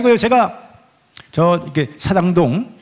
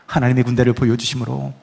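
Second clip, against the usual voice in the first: usual speaking voice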